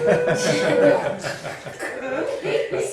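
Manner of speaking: loud rising burst of laughter; big laugh. Real, not acted. Recalling the class's reaction, loud laughter.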